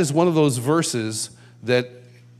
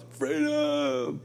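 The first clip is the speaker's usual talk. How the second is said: high, held cry kept soft, like distant thousands; mock crowd yell; 13 semitones higher, 7 dB quieter than usual. Thousands answering the worship leader, muted mock yell. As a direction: imitate a huge crowd yelling, but quietly. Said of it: "five or ten thousand people yell".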